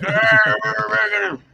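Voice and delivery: high, hammed-up old sea dog yarn; old fisherman voice. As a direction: spin it like a tall-tale fisherman. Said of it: "I sound like one of those old fishermen".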